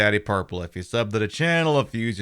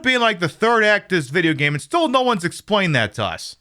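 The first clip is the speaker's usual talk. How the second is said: higher, louder exasperated complaint; 8 semitones higher, 3 dB louder than usual. Real, not acted. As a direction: throw up your hands at the game. Exasperated rant at game.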